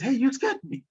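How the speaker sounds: woman caught off guard by the lion, startled. Acted. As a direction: jump in fright as the woman.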